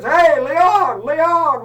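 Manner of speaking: loud, high hail across a room; calling out. Retold or imitated. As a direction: yell to stop him leaving. Calling after Leon leaving the bank.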